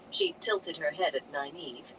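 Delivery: plain reading voice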